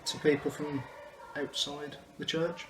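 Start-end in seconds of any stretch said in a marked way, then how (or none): none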